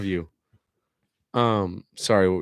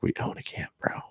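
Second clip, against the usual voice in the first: whisper; 6 dB quieter than usual. Retold or imitated. Recounts what he tells brother.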